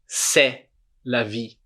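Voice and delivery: "C'est la vie" slowly, "C'est" as seh; drawn-out "C'est la vie" with no T; silent T. Shown as a demonstration. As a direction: slowly model "C'est" ending on the vowel. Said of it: "Não pronuncia o T".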